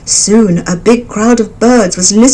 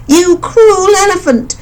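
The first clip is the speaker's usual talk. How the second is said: high, slow bird voice, reproachful; accusing mother bird; 12 semitones higher, 3 dB louder than usual. A narrator's reading. Mother bird accusing the elephant, high and slow. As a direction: scold the beast who killed your chicks.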